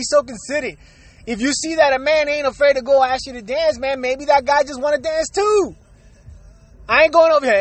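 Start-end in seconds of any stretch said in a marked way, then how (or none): none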